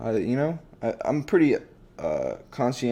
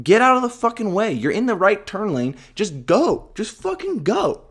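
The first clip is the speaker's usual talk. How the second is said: higher, louder angry yell at the driver ahead; road rage yelling; 8 semitones higher, 4 dB louder than usual. Retold or imitated. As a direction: bark at the car that won't turn.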